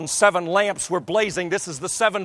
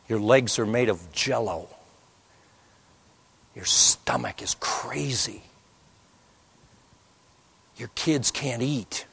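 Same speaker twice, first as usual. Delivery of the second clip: hushed; 6 semitones lower, 4 dB quieter than usual. Acted. Listeners imagine fear at home.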